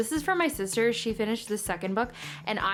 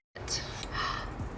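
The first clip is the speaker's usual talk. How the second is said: gasp; 7 dB quieter than usual. Real, not acted. Reacting to a great line.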